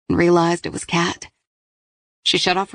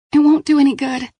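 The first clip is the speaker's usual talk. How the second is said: high, louder resigned warning; young woman voice; 9 semitones higher, 5 dB louder than usual. Acted. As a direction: voice the weary captive. Paige to Kat.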